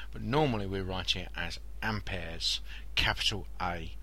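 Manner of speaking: soft whispered aside to listeners. Real, not acted. Quiet aside in lecture.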